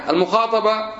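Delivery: usual speaking voice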